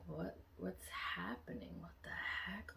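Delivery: soft confused inner voice, nearly whispered. Retold or imitated. Girl wonders silently on the hill, soft and baffled. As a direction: think it to yourself, baffled. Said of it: "Like, in my head".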